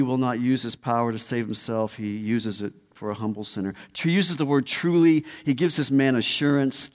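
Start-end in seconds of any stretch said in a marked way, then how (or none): 4.7-5.2 "truly" pitched high and loud; emphatic. Retold or imitated. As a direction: lift and punch the word "truly" to assure. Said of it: "is emphatic".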